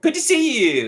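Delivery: cheerful hello that lifts as it goes; friendly and confident. Shown as a demonstration. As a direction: greet them like an old friend. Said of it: "try to be friendly".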